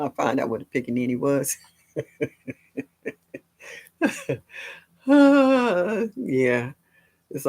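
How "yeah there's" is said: laughing. Real, not acted. Laughing at pickaninny memory.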